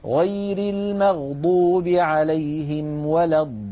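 slow tajweed chant with the long madd in الضالين; Quran recitation. Shown as a demonstration. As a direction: recite the verse melodically, holding the madd. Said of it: "مدا طويلا". (تلاوة مرتلة بمد لازم)

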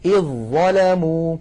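"إذ ظلموا" with a doubled ظ and no ذ; نطق إذ ظلموا بظاء مشددة; assimilated recitation. Shown as a demonstration. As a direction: glide from إذ straight into ظلموا. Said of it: "على طول مباشرة إلى الظاء".

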